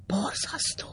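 hissed whisper, breath only. A narrator's reading. Klébe hisses his shock at the betrayal in a whisper. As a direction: hiss it out in horror. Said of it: "sziszegte Klébe úr".

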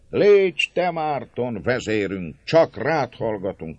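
felolvasott tömegkiáltás, emelt hangon; kiálts be a tömegből; kiáltás. A narrator's reading. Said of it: "kiáltott több hang". (bekiáltva, magasabb hangon)

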